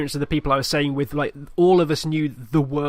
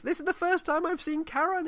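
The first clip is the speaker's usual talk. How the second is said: amazed; 14 semitones higher, 4 dB quieter than usual. Retold or imitated. Shipmate amazed by the kraken.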